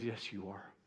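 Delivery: very soft and low, gently insisting; quiet reassurance, barely above a hush; tender. Acted. Jesus gently insists she is his daughter, soft and low. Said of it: "the tenderness of that".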